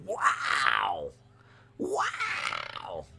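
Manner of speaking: hammed-up wonder, fading; drawn-out astonished gasp of a word; mock awe. Acting amazed reaction to his accent.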